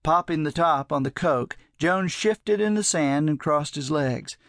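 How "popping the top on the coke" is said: ordinary delivery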